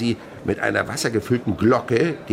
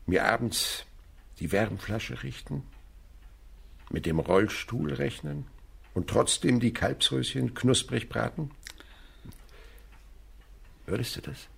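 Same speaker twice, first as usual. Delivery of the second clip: tender proposal; 8 dB quieter than usual. Real, not acted. Old man proposing to housekeeper.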